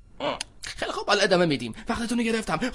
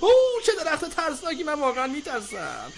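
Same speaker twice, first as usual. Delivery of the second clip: soft, mocking bravado; soft sarcastic mock fear; 5 semitones higher, 5 dB quieter than usual. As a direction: sneer at the jungle's danger. Mocking the scary jungle.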